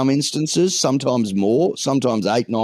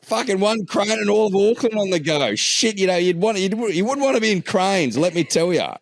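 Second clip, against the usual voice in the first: mock outrage rant; 10 semitones higher than usual. Ranting about cranes in Auckland.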